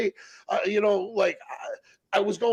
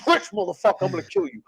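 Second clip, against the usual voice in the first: retold angry threat; 4 semitones higher, faster than usual.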